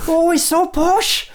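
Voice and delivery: high, drawn-out mock Northern accent; Northern girls. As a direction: squeal it slowly in a hammed-up Northern accent. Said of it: "these two Northern girls".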